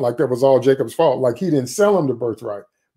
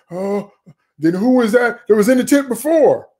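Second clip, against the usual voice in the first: put-on trembling old father; 8 semitones higher, 3 dB louder than usual. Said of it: "And then Isaac started trembling".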